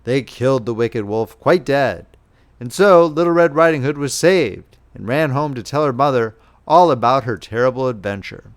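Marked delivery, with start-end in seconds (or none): none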